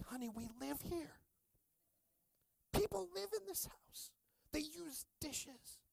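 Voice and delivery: high, soft pleading voice to his wife; mock exasperated husband. Husband reminding his wife people live here, pleading high and soft. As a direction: plead gently, then drawn out slow.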